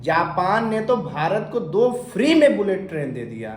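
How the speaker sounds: ordinary talk, nothing marked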